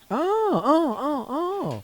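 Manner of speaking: high drawn-out oh of surprise; surprised. Real, not acted. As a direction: realise you were wrong, high. Caught out, backs down.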